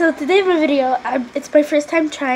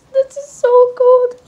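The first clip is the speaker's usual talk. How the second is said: loud high yelp; shouting from cold; 10 semitones higher, 9 dB louder than usual. Real, not acted. Holding snow, yells.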